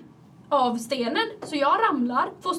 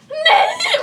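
high, loud "Nej!" through a laugh; horrified laughing shriek; 11 dB louder than usual. Retold or imitated. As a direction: squeal it in mortified panic. Realising her mistake on stage. (högt skrattande skrik av skam)

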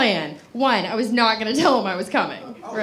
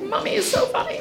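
higher, whispered through laughter; laughing whisper; 9 semitones higher, faster than usual. Retold or imitated. Imagining future kids, whispered and laughing. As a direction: giggle the kids' line under your breath. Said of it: "They'll be like".

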